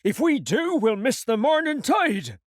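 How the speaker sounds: Long John protesting. Acted. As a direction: raise your voice against the order. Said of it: "cried Long John".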